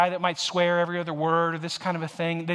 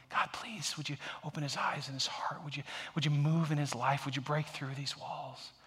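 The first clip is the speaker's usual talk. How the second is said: softly whispered plea, retold; hushed prayer under the breath; 7 dB quieter than usual.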